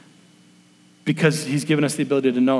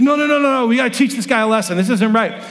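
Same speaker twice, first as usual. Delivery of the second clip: insistent dad; 6 semitones higher, faster than usual. Retold or imitated. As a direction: refuse them, worked up and fast. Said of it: "And he's like".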